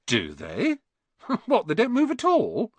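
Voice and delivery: high, incredulous question; lightly voiced boy taken aback in wonder; amazed. Ron hearing that Muggle photos don't move, amazed. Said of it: "Ron sounded amazed".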